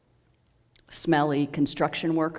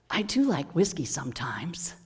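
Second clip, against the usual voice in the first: gentle whisper. Retold or imitated. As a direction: whisper kindly like a grandfather. Old man speaks gently.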